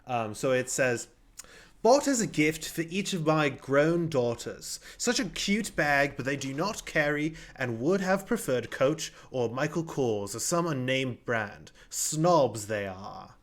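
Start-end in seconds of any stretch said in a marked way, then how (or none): 1.8-13.4 slow, put-on British accent. Host reading a customer's chicken purse review aloud, British accent. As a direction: read it out in a measured British accent. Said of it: "has to be read in a British accent".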